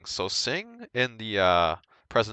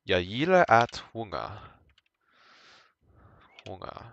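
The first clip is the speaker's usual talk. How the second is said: Swedish sentence; 4 dB louder than usual. Shown as a demonstration. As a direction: say the sentence in Swedish, then repeat "Sjunga". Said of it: "we're gonna use".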